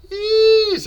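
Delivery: high squeaky yeesh of shock; surprised yeesh. Real, not acted. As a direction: yelp it out in disbelief. Reacting to big contract.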